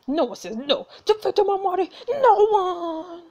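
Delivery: high, loud villain rage; angry villain. Acted. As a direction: roar it as the villain.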